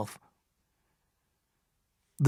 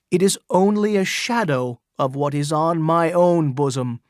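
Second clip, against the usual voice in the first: louder, raised public address; crying out to the crowd; 3 semitones higher, 4 dB louder than usual. Acted. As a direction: proclaim it to the whole crowd. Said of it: "he cried".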